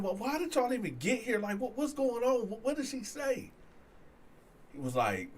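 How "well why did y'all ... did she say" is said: high-pitched, baffled questions; retold bewilderment, voice pitched well up; urgent questioning. Accused man questioning his friend, baffled and high-pitched.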